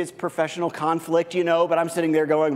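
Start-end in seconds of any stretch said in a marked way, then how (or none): none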